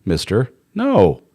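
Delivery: high, mock-firm wife putting her foot down; wife telling husband to stay, firm but high; standing firm wife. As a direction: stop him, then soften with a sorry. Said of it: "as opposed to going".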